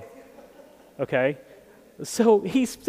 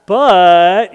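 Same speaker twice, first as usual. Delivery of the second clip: loud emphatic but; 4 semitones lower, 10 dB louder than usual. Real, not acted. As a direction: punch the word But loud. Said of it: "emphasizing the but".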